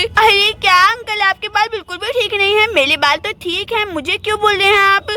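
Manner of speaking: put-on childish lisping chatter; little girl Gudiya. Girl hurrying to fetch milk before school, childish lisp. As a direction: talk like a small lisping girl.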